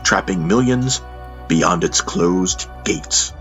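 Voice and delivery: normal talking voice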